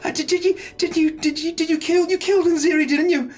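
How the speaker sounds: high, fussing mother voice; worried mother. Acted. Mother hugging her child tighter after battle, worried fussing. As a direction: pour out anxious questions in a high voice.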